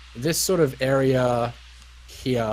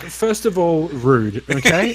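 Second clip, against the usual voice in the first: indignant mock; 5 semitones higher, 8 dB louder than usual. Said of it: "you're just like".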